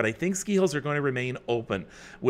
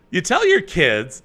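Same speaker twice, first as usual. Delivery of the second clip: normal talking voice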